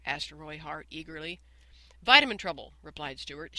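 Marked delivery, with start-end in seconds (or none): 2.0-2.8 loud answer. Acted. Teacher answers pupil's question.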